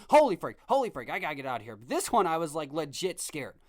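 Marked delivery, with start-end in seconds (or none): 0.1-0.6 loud, high burst of panic; panicked yell. Retold or imitated. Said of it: "I'm not sitting there going". 0.7-1.8 fast, tumbling mock panic at usual volume; rushed panic. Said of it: "I'm not sitting there going".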